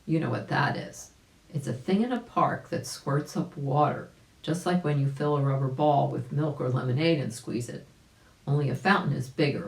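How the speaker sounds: usual speaking voice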